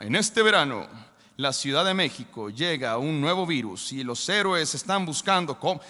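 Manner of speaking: low, dramatic trailer narrator; movie trailer voice. Retold or imitated. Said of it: "la clásica voz de trailer".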